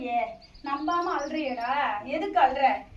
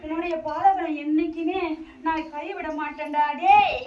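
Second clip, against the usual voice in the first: loud, higher, commanding rebuke; possessed goddess shouting; 3 semitones higher, 7 dB louder than usual. Real, not acted. Goddess through medium scolds devotee for losing heart, loud.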